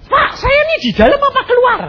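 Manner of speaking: high, rushed, panicky; frantic question. Retold or imitated. Terrified caller asking the pastor whether to flee, fast and high. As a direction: blurt it out fast in fear.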